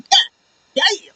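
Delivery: high-pitched stunned yelp; shocked exclamation. Retold or imitated. Fan retelling his reaction to the spike, high shocked yelp.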